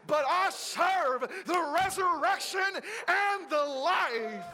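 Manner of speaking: full-force preaching. Real, not acted. Preacher shouting praise to the congregation.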